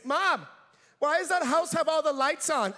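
a hint of a child's voice; kid asking mom. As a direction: call out a curious kid's question.

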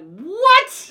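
high, loud disbelieving what; shocked what. Retold or imitated. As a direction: blurt it out in total disbelief. Reacting to child soldiers sent.

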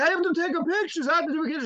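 put-on high, thrilled German accent; excited German tourist meeting Krampus, thick German accent. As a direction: gush like an excited German dad. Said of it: "So he's like".

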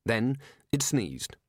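ordinary delivery

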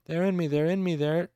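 high terrified chant; panicked screaming. A narrator's reading. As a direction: endless frantic repetition. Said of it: "the screaming continued in an unbroken chant".

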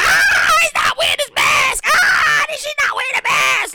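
high, panicked shrieks; mock panic at a maskless man; freaking out. Rider freaking out over a maskless stranger, high shrieking. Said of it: "you start freaking out".

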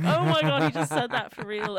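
shocked exclamation, loud; loud, high mock surprise; retold shock. Retelling own shocked reaction.

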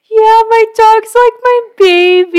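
put-on high, cooing baby voice. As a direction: coo it like talking to a puppy. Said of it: "your baby voice".

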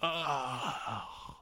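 acted dying groan; long expiring "oh" of a dying man; last breath. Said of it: "lets out a last breath".

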